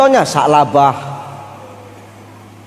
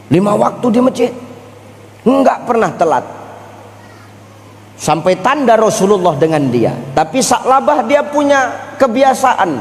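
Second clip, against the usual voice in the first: ordinary delivery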